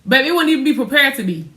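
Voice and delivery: high, loud teasing challenge; high, loud teasing jab at her husband; playful mock outrage. Real, not acted. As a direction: throw it back at him, playfully outraged.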